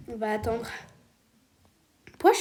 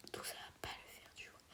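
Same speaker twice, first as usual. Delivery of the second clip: whisper; 25 dB quieter than usual. Real, not acted. Whispers while sneaking in game.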